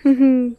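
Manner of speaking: short bright laugh; delighted laugh. Real, not acted. Delighted at the animals.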